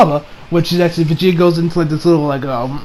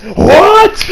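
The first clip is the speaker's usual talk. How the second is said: sharp surprise; 13 semitones higher than usual. Retold or imitated. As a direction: snap it out like someone just insulted you. Caulifla reacts before powering up.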